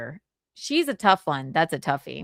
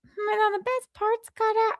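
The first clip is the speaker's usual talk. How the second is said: much higher, slower voice; Chrissy Mayer impression. Imitating streamer Chrissy with a high, thin voice. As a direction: lift the voice up high as a light female impression. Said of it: "Chrissy Mayer impression".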